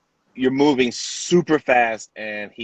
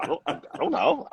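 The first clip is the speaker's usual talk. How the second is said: nervous laugh; 9 semitones higher, 3 dB quieter, faster than usual. Retold or imitated. Nervous answer on set.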